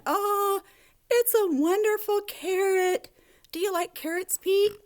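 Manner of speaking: high, loud squeal of delight; excited, high-pitched cooing. Pretend. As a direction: gush over a treat as if to a pet. Delighted reveal of the puppet's treat.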